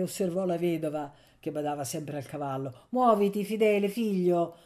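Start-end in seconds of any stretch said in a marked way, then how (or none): 2.9-4.6 louder, higher urging; raised, mildly impatient voice; a little impatient. A narrator's reading. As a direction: hurry him along with some impatience. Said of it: "con un po' di impazienza".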